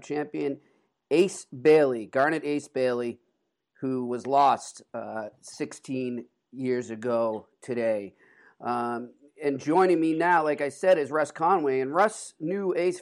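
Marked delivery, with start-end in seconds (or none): none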